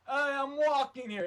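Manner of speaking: loud, high, slow New York protest; New York accent. Retold or imitated. Man bumped on a crosswalk, loud New York shout. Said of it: "he was walking in New York".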